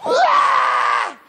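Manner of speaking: high, loud mock scream; piercing acted cry of pain; child's wail. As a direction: shriek like a jabbed toddler. Child stuck with the needle.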